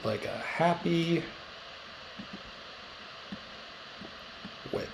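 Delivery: very slow, with long suspenseful pauses; drawn-out reveal. Acted. Teacher sharing a weird map thought with class, slow suspenseful reveal. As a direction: stretch each phrase and hold the punchline back.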